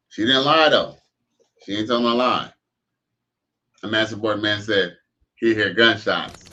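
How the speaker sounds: ordinary delivery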